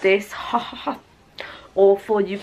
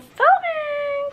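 high squeal. Shown as a demonstration. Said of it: "We're gonna do some filming today".

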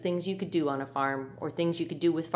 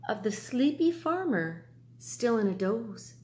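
plain reading voice

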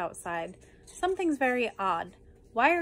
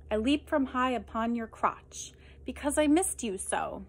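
ordinary delivery

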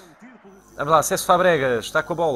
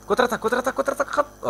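much higher, hurried contra-ataque; urgent shout; 9 semitones higher, faster than usual. Real, not acted. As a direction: urge the team forward. Counterattack in the game.

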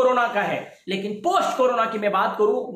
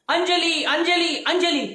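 imitating a second madman, higher pitched calling; 6 semitones higher, slower than usual. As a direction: call the name out, pitched up. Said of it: "दूसरा पागल भी चिल्लाता है". (पागल की नकल में ऊँची पुकार)

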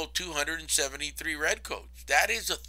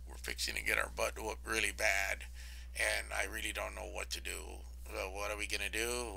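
softer, lower, a hammed-up frightened colonist; mock scared; 7 semitones lower, 15 dB quieter than usual. Lecturer voicing scared colonists facing the British fleet, mock fearful and much softer. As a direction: play the panicked colonists, hushed and worried. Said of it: "really scared".

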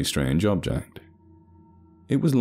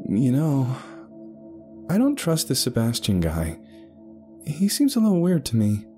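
higher-pitched female character voice; wary woman confiding; 8 semitones higher than usual. Acted. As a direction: confide your suspicion to a companion. Ada confides distrust of Sebastian.

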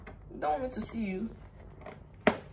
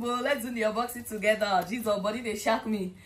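ordinary talk, nothing marked

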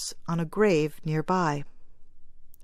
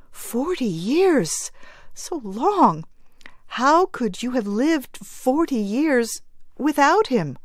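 amazed; 7 semitones higher than usual. Acted. Amazed grieving mother.

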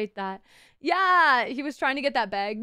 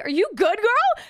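excited; 8 semitones higher, 4 dB louder than usual. Retold or imitated. Greeting returning friend.

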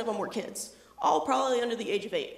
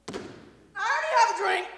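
put-on child voice; 10 semitones higher, 4 dB louder, faster than usual. Kid answering, voiced high.

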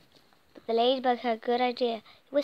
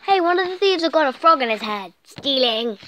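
loud; 3 semitones higher, 8 dB louder than usual. Acted. Excited shout in story.

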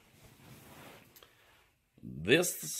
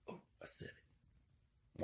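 slow guilty whisper; hushed, slow whisper to himself; 26 dB quieter, slower than usual. Real, not acted. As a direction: breathe it out as if caught. Whispers after risky remark.

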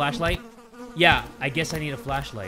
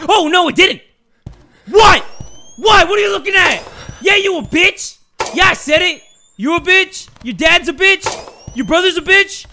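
high, loud trash talk; heated yelling; 12 semitones higher, 9 dB louder than usual. Real, not acted. Player cursing out the ghost, heated yelling. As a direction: hurl insults at the top of your voice.

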